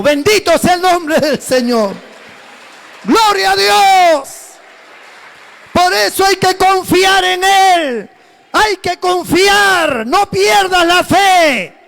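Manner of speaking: slow, loud preaching exhortation; fervent pulpit call. Acted. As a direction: press it home to the congregation, slowly.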